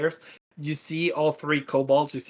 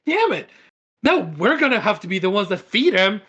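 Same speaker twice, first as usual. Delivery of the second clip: high, loud griping kobold; loud, high exasperation; pissed-off kobold; 5 semitones higher, 5 dB louder than usual. Acted. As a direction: gripe loudly in a raised creature voice. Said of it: "they're just pissed off".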